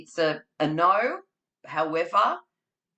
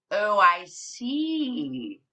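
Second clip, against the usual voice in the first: slow, drawn-out mouse reply; knowing mouse; 2 semitones higher, much slower than usual. Acted. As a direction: answer the bear cagily. Mouse answers bear.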